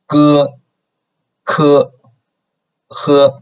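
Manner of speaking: slow, clear teacher demo of each initial; Chinese initials read aloud. Shown as a demonstration. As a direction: say each sound crisply for the class to repeat. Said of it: "Please read it together".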